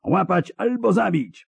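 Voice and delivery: high-pitched roar of command; commander's high, urgent yell; roared order. A narrator's reading. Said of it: "ryknął Szkelen".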